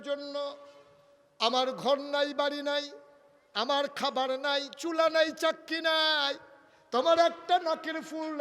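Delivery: high, full-force lament as Ali; impassioned preaching. Acted. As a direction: cry out the poor husband's confession, loudly. Preacher voices Ali's lament. (উচ্চকণ্ঠে আবেগভরা was)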